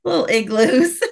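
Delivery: amused repeat. Real, not acted. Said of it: "you said igloos".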